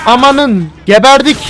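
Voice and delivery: high, loud mock panic; panicked cry. Real, not acted. Gamer panicking as police join the shootout. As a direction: cry out like it's all over.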